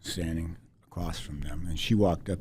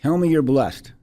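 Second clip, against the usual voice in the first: much louder and higher, an insistent Liberian woman; firm Liberian accent; 8 semitones higher, 11 dB louder than usual. Retold or imitated. Woman pressing the reluctant wife again, loud Liberian accent. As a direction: demand it once more in a Liberian accent. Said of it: "in her Liberian accent".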